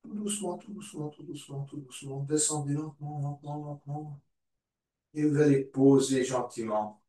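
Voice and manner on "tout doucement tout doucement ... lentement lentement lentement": very soft, lulling coach voice, fading away; soft guiding fade. Real, not acted. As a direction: ease them down, voice dropping to a murmur. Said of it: "Et puis on descend". (tout doucement, voix très douce qui s'éteint)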